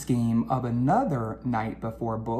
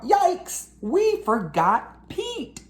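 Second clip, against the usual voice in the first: cried out; 14 semitones higher, much slower than usual. Acted. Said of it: "I suddenly cried out".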